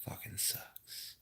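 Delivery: deadpan whispered curse; whispering. Pretend. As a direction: whisper it gloomily. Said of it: "to just be quiet".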